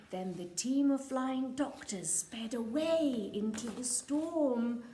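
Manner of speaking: usual speaking voice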